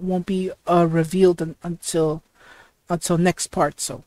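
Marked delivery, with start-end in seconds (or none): none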